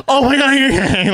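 loud, high mock freak-out; imitated panic. Surf teacher running to a learner, imitated panicked shout. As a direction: cry out like an alarmed instructor.

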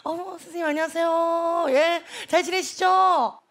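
imitating mom's polite phone voice, endings stretched out; 엄마의 전화 응대. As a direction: draw out the courteous greeting like mom on the phone. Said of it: "엄마가 전화를 받아". (엄마가 학원 선생님 전화 받듯 길게 늘여 공손하게)

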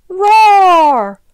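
big, high, loud drawn-out roar; snarled tiger roar. A narrator's reading. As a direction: roar it out like a pouncing cub. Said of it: "pounced after it and snarled".

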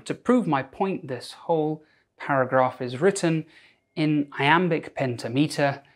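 ordinary talk

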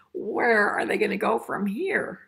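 usual speaking voice

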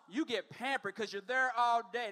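usual speaking voice

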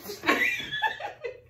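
shrieking high laugh. Real, not acted. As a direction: burst into squealing laughter. Partner misses throw.

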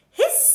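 short, loud hiss; goose hiss. A narrator's reading. Storyteller plays the goose, loud hissing. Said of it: "said the goose".

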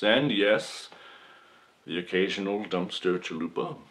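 ordinary talk, nothing marked

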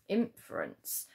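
ordinary talk

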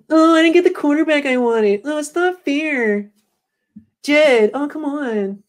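high, breathy-free whining falsetto, slow on "not fair"; pleading whine in a high voice. Retold or imitated. Mocking [name] whining to owner [name], high whine. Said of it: "that's my [name] impression".

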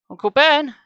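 very high, loud call; shout. A narrator's reading. Gabe calls for his missing uncle, shouting. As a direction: shout the name into the room. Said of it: "I shouted".

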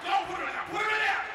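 very high, loud yelling; screeched order; frantic shout. Acted. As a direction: shout at the clerk in greedy panic. Greedy reaction to donut deal.

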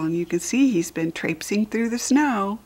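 ordinary delivery, nothing marked

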